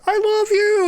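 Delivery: put-on very high, loud little kid voice; tiny kid declaring love, high and loud; imitating a little kid. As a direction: squeak it out like a sweet small child. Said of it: "a kid that's like".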